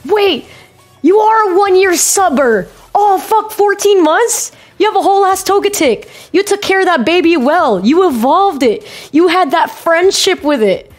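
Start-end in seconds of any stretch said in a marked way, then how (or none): none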